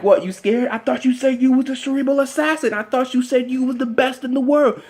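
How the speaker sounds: high-pitched needling wrestler voice; mocking taunt, imitated. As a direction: taunt a scared rival in a high put-on voice. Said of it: "And Punk's like".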